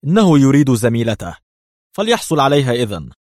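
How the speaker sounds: worked-up and indignant; furious outburst. A narrator's reading. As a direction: burst out in anger, voice lifted. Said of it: "وهو يستطرد في ثورة".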